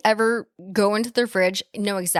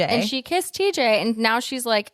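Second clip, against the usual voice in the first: normal talking voice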